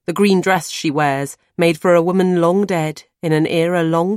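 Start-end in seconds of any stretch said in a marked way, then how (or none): none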